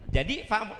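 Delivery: ordinary talk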